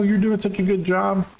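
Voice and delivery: high, sing-song praise voice; cutesy high voice. Pretend. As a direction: coo it like praising a pet. Cooing praise to himself.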